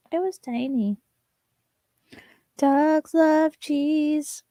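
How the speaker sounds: high, sing-song pet voice. Real, not acted. To her dog Muffin, high cooing baby talk. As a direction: coo at the dog.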